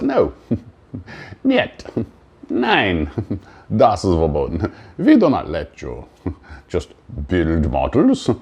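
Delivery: put-on silly made-up European accent mixing niet and nein; vague fake foreign accent. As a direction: ham up an unplaceable foreign accent as Life itself. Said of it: "Why am I doing a fake accent that I can't even identify".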